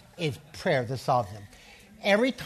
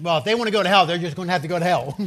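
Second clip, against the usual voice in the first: louder, half-laughing mock outburst; mock frustrated outburst; 2 semitones higher, 7 dB louder, faster than usual. Preacher mocking believers who give up on relatives, louder half-laughing outburst.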